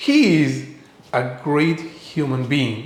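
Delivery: he is, each word slow and apart; slow full form. Shown as a demonstration. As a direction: say "He is a great human being" slowly, every word separate. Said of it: "contraction-ിലിട്ട് പറഞ്ഞേ". (പതുക്കെ, മുഴുവൻ രൂപത്തിൽ)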